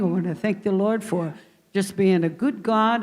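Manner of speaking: normal talking voice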